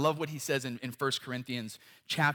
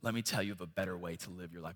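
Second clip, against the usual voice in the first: begging daughter; 4 semitones lower, 6 dB quieter than usual. Retold or imitated. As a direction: plead gently with a wandering child. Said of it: "I would be begging her".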